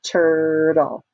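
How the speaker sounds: "Turtle" in two stretched, level beats; split into syllables. Shown as a demonstration. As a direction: clap out "Turtle" as TUR-TLE. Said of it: "Two syllables".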